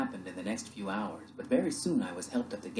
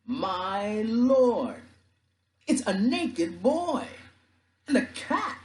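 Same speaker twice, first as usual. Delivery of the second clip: high, drawn-out amazed holler; loud, slow shout of astonishment; astonished yell; 12 semitones higher, 6 dB louder, much slower than usual. Acted. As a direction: yell back to the boat in disbelief. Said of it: "he yelled to someone on the boat".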